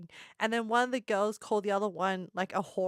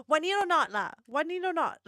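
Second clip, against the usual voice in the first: higher, pushier mock Singaporean Want eat or not lah; Singaporean accent; 5 semitones higher than usual. Vlogger hams up the Singaporean pickup line in a thick Singaporean accent. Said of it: "really strong, thick Singaporean accents".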